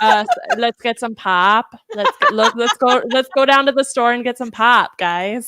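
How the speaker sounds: loud, hammed-up Minnesota accent. Friend recalls picking up vacation talk, loud Minnesota accent. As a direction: overdo the Minnesota vowels, especially on pop. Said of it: "family friends from".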